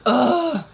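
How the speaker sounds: loud high ugh of dismay; loud frustrated groan. Real, not acted. Reader groaning at the book's twist, loud. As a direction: groan at the page. Reacting to a plot turn.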